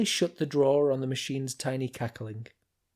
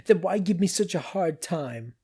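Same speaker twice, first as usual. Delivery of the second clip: shouting; 6 semitones higher, 3 dB louder than usual. A narrator's reading. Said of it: "the little machine shouted".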